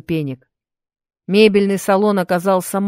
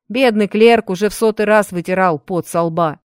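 ordinary delivery

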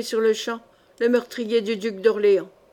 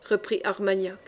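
ordinary delivery

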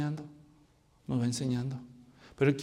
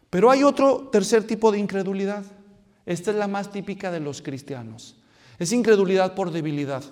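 ordinary talk

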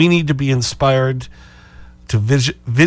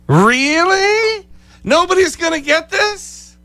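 much higher, incredulous teasing; mock disbelief; 3 dB louder, slower than usual. Real, not acted. Host teases listeners for not calling, incredulous and high. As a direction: squeal it in mock astonishment. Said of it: "host teasing silent callers".

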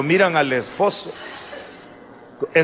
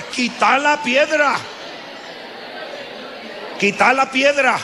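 shouted command; 10 semitones higher than usual. Shown as a demonstration. Preacher leading the congregation to shout "Quita la piedra". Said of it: "Dígale, por favor".

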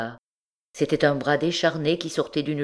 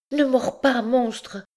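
crying out; 10 semitones higher, slower than usual. A narrator's reading. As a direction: shriek it as she fights him off. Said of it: "cria-t-elle".

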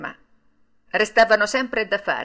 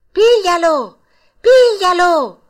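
much higher, much louder shout; yelling; 11 semitones higher, 11 dB louder, slower than usual. A narrator's reading. Geppetto chasing Pinocchio, yelling to the passers-by. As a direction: bellow after the runaway in full cry. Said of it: "urlava Geppetto".

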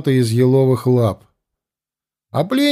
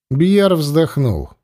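usual speaking voice

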